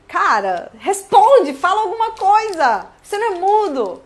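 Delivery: loud, high, hammed-up impatient demand; mock exasperation. Acting out someone demanding an answer from a silent traveller, exasperated.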